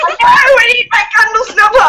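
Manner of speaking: put-on high, loud, frantic old witch; panicky witch. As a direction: shriek like a flustered witch through laughter.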